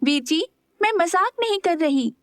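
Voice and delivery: mild cross insistence; mildly annoyed. A narrator's reading. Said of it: "Meera हल्के गुस्से से बोली". (हल्के गुस्से से, ऊँची आवाज़ में)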